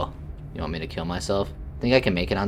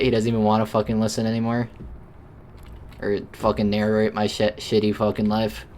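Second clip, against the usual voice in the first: normal talking voice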